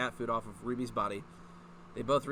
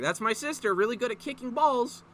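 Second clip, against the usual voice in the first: higher, breezy jock swagger; a light imitation of a confident sporty girl; casual soccer confidence; 8 semitones higher, 3 dB louder than usual. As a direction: toss it off like a cocky soccer player. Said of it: "said with all of the casual confidence of someone who played soccer".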